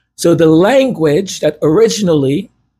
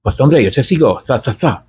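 Castilian Spanish accent; 4 semitones lower, faster than usual. Shown as a demonstration. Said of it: "Spain is like".

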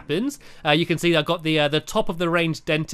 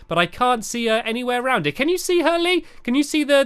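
ordinary delivery

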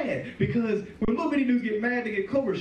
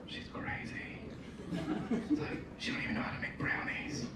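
whispered, much softer; under the breath; 4 semitones higher, 13 dB quieter than usual. Retold or imitated. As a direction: mutter it so she can't hear. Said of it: "talk shit under our breath".

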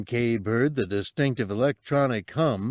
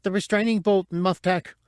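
urgent small creature voice; 9 semitones higher than usual. Acted. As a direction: warn him off in a high little voice. Cabe shouts urgent.

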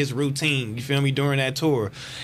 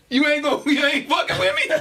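laughing plea; 10 semitones higher than usual. Retold or imitated. Pleading, laughing, retold.

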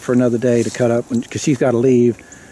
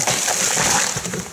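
breathy laugh of relief; 5 dB quieter than usual. Real, not acted. Laughing after the tree falls.

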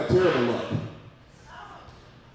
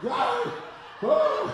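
high, breathless whoos; mock gasping for air, high-pitched; gasping relief; 11 semitones higher, much slower than usual. Grown men leaving masked places, hammed-up high gasps. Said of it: "knocking down little kids".

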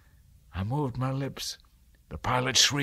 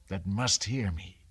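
ordinary delivery

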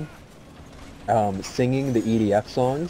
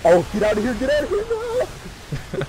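panicked high-pitched shriek, rising higher; panicking gamer. Real, not acted. Swarmed by enemies, going down.

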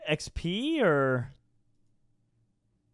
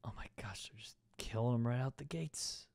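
whispering; 5 semitones lower, 14 dB quieter than usual. Real, not acted. Watching enemies die, whispered.

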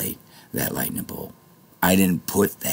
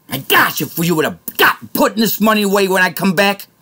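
furious rant; 10 dB louder than usual. Real, not acted. Ranting furiously at viewers.

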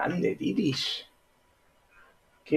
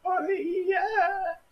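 mock crying; 5 dB quieter than usual.

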